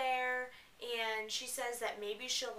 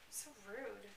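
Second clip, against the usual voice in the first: muttered snarky aside, much softer; quiet eye-rolling remark; rude aside; 12 dB quieter than usual. Real, not acted. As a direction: toss it off under your breath. Mocking aside while reading.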